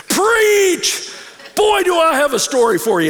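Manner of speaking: high booming shout; big booming voice. Retold or imitated. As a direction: boom it out like a friend bursting in with news. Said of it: "he had this big booming voice".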